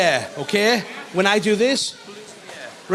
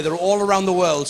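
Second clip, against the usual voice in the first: ordinary talk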